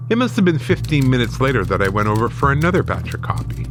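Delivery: ordinary delivery, nothing marked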